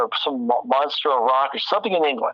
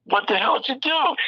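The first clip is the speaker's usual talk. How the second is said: incredulous; 13 semitones higher than usual. Retold or imitated. Retold shocked question to drummer.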